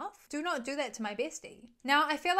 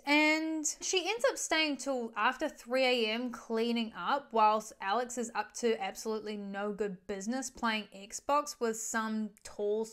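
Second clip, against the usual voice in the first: usual speaking voice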